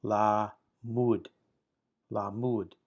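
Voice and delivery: "la mud" with a clear break between la and mud; "la mud", each syllable given its own beat; syllables divided. Shown as a demonstration. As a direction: say "la mud" as two separate syllables. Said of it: "Can you hear the syllable division".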